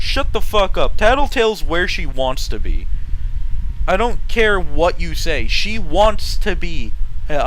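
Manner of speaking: loud, emphatic ranting; angry rant. Real, not acted. Telling fans off about Tattletale, loud and angry.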